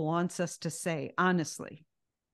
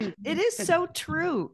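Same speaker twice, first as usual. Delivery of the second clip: excited agreement; 10 semitones higher, 4 dB louder, slower than usual. Real, not acted.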